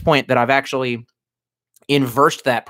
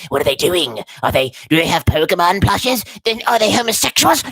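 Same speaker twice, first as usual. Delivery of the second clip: higher, nosy landlord gawking through the window; mock the suspicious property manager, pitched up; landlord impression; 5 semitones higher, 3 dB louder than usual. Streamer mocks landlords peeking in, in a high nosy voice. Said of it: "that's my actual impression of our landlords".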